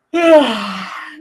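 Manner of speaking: a big, open demonstration yawn; long audible yawn sigh. Shown as a demonstration. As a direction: breathe out a loud yawn for the room. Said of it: "please yawn".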